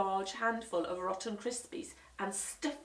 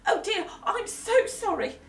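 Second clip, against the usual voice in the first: higher, louder fluttery apology; apologetic mum; 13 semitones higher, 7 dB louder than usual. Acted. Mum apologising to guests, flustered.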